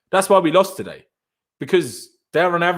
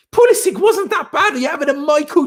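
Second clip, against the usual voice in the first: mocking rival fan; 8 semitones higher than usual. Streamer mocking an Arsenal fan's claim, high and laughing. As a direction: parrot the claim back with a scoffing laugh.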